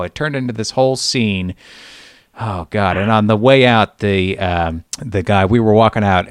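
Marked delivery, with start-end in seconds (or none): none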